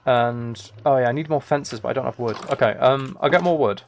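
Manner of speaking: usual speaking voice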